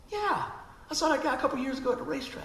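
very high, fast eager reply; rushed high-pitched recognition; excited yes. Retold or imitated. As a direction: blurt it out high and quick. Recalling seeing the guy.

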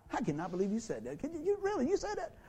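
shocked disbelief. Retold or imitated. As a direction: voice jumps high in mock disbelief. Family member snapping at you.

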